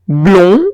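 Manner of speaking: Blond with a closed nasal on. Shown as a demonstration. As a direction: contrast Blond with France. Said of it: "blond es más cerrado que France".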